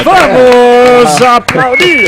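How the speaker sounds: high, loud, drawn-out call; rousing shout. Real, not acted. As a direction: whip up applause with stretched vowels. Host calls for applause. (grito agudo e prolongado pedindo aplausos)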